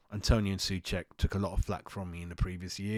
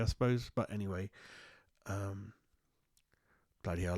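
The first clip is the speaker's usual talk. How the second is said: ordinary delivery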